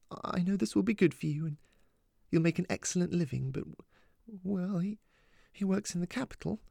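high, halting, close to crying; tearful. A narrator's reading. Mum scared her daughter will leave, tearful. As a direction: stumble through it, holding back tears. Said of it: "making her mother cry".

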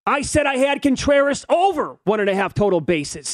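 high-pitched outraged gambler voice; hammed-up yelling gambler; mock irate bettor. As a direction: rant like a bettor who just lost. Voicing irate bettor in brawl.

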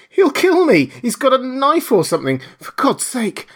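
high, loud panicked cries; frantic terror. Acted. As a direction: scream as the killer breaks in. Marvel frantic, hunted by killer.

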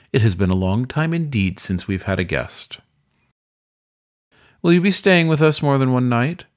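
a hint of a polished weasel concierge; suave weasel host. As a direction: play the gracious old hotel keeper welcoming a guest. Weasel host greets Francis.

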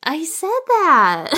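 loud, high, drawn-out complaint; exasperated protest. Real, not acted. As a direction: protest at not being listened to. Co-host protests she said it already.

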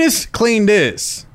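put-on high, drawn-out order to a kid; hammed-up calling out; mock yelling. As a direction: holler slowly like a parent. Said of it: "It's a great yelling name".

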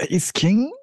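unconvincing, rising question; tone contradicting words. Real, not acted. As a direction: say it without believing it. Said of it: "That, that, that didn't sound very convincing".

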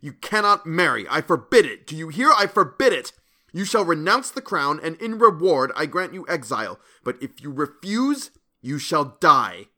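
loud, high, raving commands; furious shout. A narrator's reading. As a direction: tyrannical shouted ultimatum. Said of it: "I shouted".

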